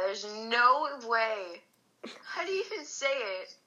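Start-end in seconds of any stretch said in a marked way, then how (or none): none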